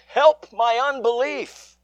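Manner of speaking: high, slow imitated plea; cry out. Preacher echoing the father's cry to the congregation, pleading. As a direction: echo the father's desperate cry. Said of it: "Us who cry out".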